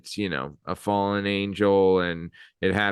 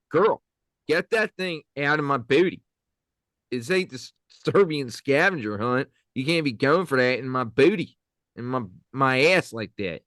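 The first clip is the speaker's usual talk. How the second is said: a lightly done Philly-area Delco voice with sass; sassy Delco girl telling someone off; Delco accent; 2 semitones higher than usual. Acted. Brushing off a friend in a hammed-up Delco accent. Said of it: "that was [name]'s Delco".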